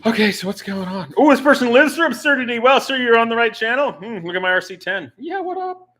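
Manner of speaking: high, loud mock-excited reaction; put-on onlooker voice. Streamer voicing someone finding his absurd channel, high hammed-up voice. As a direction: push it up high and loud, hamming it up.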